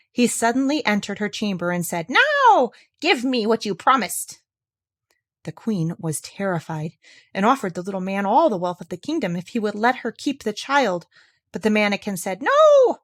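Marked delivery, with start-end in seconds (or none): 2.1-4.4 slow, higher, louder demand; demanding little man. Acted. 12.4-13.0 very high, loud refusal; shrill little man. Acted.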